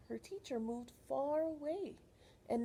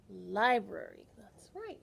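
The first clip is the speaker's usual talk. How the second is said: usual speaking voice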